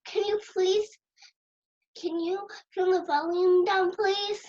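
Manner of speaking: polite yell. Acted. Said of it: "yell, like, really loud and say".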